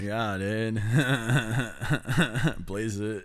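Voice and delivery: low, lazy stoner drawl; laid-back stoner bro. Pretend. Stoner bro after four twenty joke.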